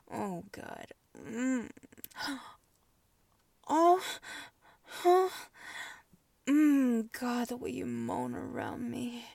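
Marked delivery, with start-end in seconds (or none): 3.7-7.1 high, loud moans. Acted. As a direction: cry out in pleasure. Moaning in pleasure.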